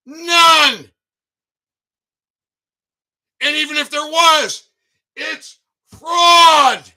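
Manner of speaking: furious rant shout. Real, not acted. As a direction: pound each word home. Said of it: "How was that for a rant".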